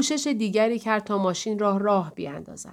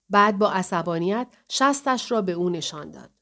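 ordinary delivery, nothing marked

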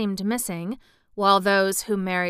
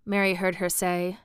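ordinary delivery, nothing marked

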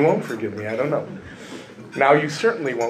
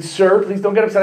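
mock soothing agent; 6 semitones higher, 4 dB louder, faster than usual. Agent calming the caller.

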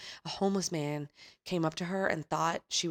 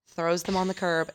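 ordinary talk

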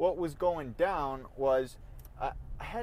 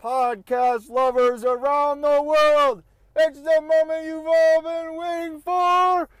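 booming showman intro, high and loud; grand entrance announcement; 10 semitones higher, 10 dB louder, slower than usual. Acted. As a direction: hype it up like a ring announcer. Self-introducing podcast like showman.

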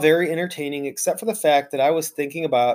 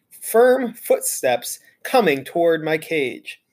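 plain reading voice

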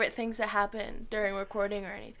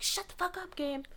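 retold snapping; 8 semitones higher, 8 dB quieter than usual. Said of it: "Carly's just like".

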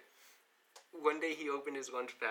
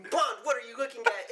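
mock teacher; 7 semitones higher, 6 dB louder than usual. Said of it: "He's like".

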